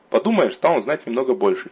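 usual speaking voice

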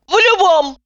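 high, loud snap; snapped retort. A narrator's reading. Patient snaps back at Lyudmila. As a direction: bite the answer off.

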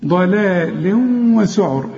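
slow ضلال وسعر, nasal hum into the w; ghunna merge. Shown as a demonstration. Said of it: "هنا في غنة".

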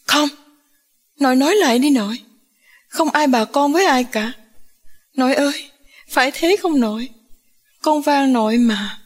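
put-on high, imploring young woman's voice; pleading. A narrator's reading. Granddaughter begs grandmother to deny it.

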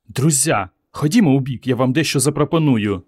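plain reading voice

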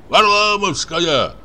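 high, drawn-out holler; old shepherd's long, high shout; loud answer. Acted. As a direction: call the answer across the field, stretched out. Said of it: "громко ответил старик".

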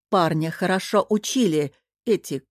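ordinary delivery

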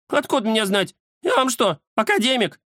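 high-pitched huffy cat; snorting dismissal. A narrator's reading. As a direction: huff it off in a high voice. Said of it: "фыркнул Кузя". (фырканье высоким голосом кота)

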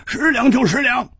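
high, insistent snap; impatient, high-voiced concession; exasperated agreement. Agrees to pay under pressure.

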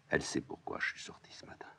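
a whispered confession. Acted. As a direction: breathe it out in a whisper. Antigone confides secret aside.

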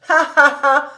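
loud, high mock guffaw; exaggerated mimicking "ha ha ha"; imitating his laugh. A narrator's reading. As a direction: throw back a derisive fake laugh. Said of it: "il imitait la façon de rire de Cobus".

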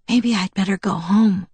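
ordinary delivery, nothing marked

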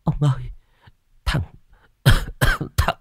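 high, stammering voice full of fear; frightened wife. Acted. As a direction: gasp it out in broken, terrified starts. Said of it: "giọng đầy sợ sệt".

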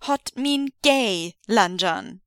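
slow, solemn gravitas. Acted. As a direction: say it gravely and slowly. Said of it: "with the appropriate gravitas".

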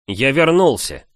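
loud, cheerful call home; shouting. A narrator's reading. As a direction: announce it loudly to the house. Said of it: "крикнул".

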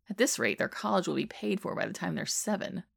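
ordinary delivery

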